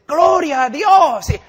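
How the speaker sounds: mock a pastor gushing before the church; hammed-up pastor. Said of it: "Y el pastor dice".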